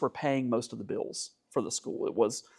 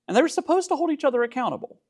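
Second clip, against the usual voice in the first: supposed pitched way up and hit hard; stress on supposed; 13 semitones higher, faster than usual. Real, not acted. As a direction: lean on the word supposed, high and quick. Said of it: "Emphasis on the word supposed".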